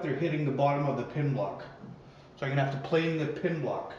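usual speaking voice

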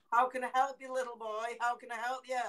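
high, urgent offer of help; concerned passer-by. Retold or imitated. Bloke asking unseen boy, imitated concerned high call. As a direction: imitate a worried stranger calling to a child. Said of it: "He went".

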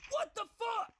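very high incredulous cry; outraged screech. Real, not acted. Gamer reacting to a bad death, outraged screech. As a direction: let the voice leap up in outrage. Enraged outburst at the game.

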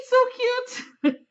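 high, loud squeal of delight. Real, not acted. Fan gushing over the Jack Sparrow figure, squealing. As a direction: gush like a fan seeing her idol.

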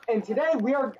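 normal talking voice

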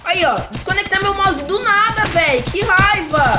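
loud frustrated outburst; angry. Real, not acted. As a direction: yell in real annoyance. Mouse disconnects mid-game.

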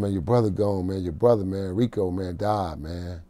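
low, quiet retelling of grave news; subdued bad news. Homie tells him his brother died, subdued and low. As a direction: break it gently, voice dropped low.

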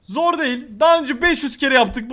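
ordinary delivery

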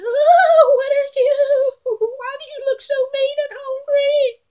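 very high, loud, squealing little goat; frightened little goat. Acted. Little goat squealing in fright at the hungry troll. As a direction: shriek like a terrified kid goat.

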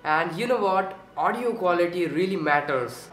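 ordinary delivery

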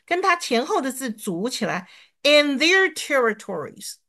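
ordinary talk, nothing marked

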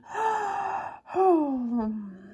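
long open-mouthed yawn; big yawn. A narrator's reading. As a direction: stretch into a wide yawn. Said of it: "she let out an awful yawn".

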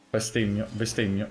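ordinary talk

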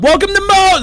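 high, loud shouted welcome; shouted restaurant greeting. Retold or imitated. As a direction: belt out the greeting like staff. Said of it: "They scream".

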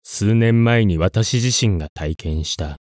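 ordinary delivery

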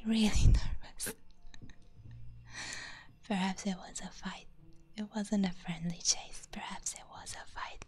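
ordinary delivery

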